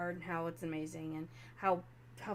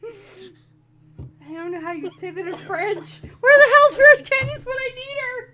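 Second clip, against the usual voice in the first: much higher and louder, laughing through the words; laughing exasperation; 13 semitones higher, 19 dB louder, slower than usual. Real, not acted. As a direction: cry out in laughing despair.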